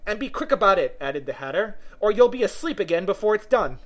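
high, loud prodding; impatient Hatter. Acted. Hatter prodding the sleepy Dormouse, impatient. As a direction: hurry the listener along.

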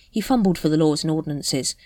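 ordinary delivery

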